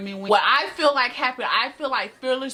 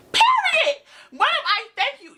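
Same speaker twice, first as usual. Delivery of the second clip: mocking squeal; 14 semitones higher, 4 dB louder than usual. Mocking customer's question, high squeal.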